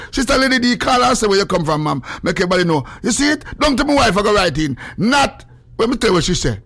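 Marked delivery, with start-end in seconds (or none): none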